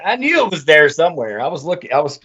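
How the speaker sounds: usual speaking voice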